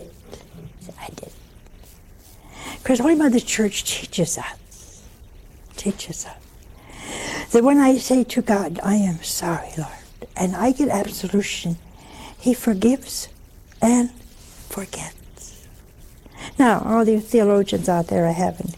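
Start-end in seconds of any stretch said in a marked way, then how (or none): 0.0-1.3 breathless, awed whisper; whispered in awe of God. Retold or imitated. Hushed awe at God's answer. 8.8-10.0 soft, unvoiced plea for mercy; whispered contrite prayer. 14.7-15.1 trailing off into a soft whisper; whispered reassurance. Real, not acted. Tender close of the teaching.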